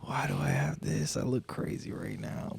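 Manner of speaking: soft whispered mock panic; hushed disbelief. Host retelling emptying his pockets, hushed disbelief. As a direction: whisper it as if caught holding it.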